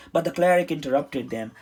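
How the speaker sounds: ordinary delivery, nothing marked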